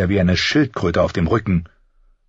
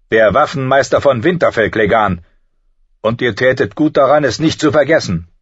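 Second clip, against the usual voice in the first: loud, higher, firm retort; stern master-at-arms; 6 semitones higher, 5 dB louder than usual. A narrator's reading. Master-at-arms warning the knight, stern and loud. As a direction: assert your rank with raised voice.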